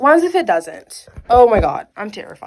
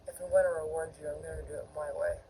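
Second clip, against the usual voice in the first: Sangwo; 6 semitones lower, 14 dB quieter, much faster than usual. Acted. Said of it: "I cannot do a Sangwo voice".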